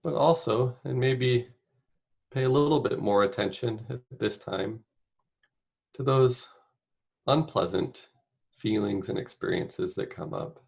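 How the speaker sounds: unhurried meditation guide, long pauses between phrases; guided meditation. Acted. Meditation leader inviting listeners to picture caregiving, spacious guided-meditation delivery. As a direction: guide them gently, phrase by phrase, leaving space.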